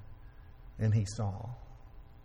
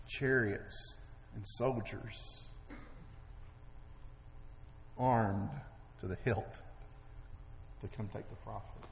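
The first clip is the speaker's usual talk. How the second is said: hushed suspense; 8 dB quieter, slower than usual. A narrator's reading. Hushed suspense after buildup.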